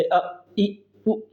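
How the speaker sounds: अ, इ, उ said short and quick; brief, quickly released अ, इ, उ; short vowels. Shown as a demonstration. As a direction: clip each vowel अ, इ, उ very short. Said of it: "बहुत जल्दी बोले जाने वाले हैं".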